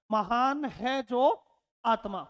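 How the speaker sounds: महान है जो आत्मा drawn out slowly; slow demo of महान है जो आत्मा; slow spaced phrase. Shown as a demonstration. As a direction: stretch each word of the phrase. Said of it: "यह प्रक्रिया क्या कहलाई?".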